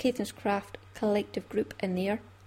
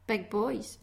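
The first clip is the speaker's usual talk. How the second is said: normal talking voice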